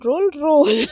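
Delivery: high, loud giggle breaking through; giggling. Real, not acted. Imagining rolling downhill, breaking into giggles. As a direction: laugh at the silly thought.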